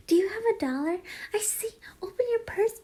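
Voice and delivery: put-on high girlish voice; anime girl. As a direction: wheedle for money like an anime girl. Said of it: "like an anime girl that was, like, asking for the money".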